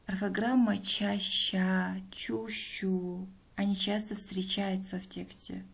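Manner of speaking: normal talking voice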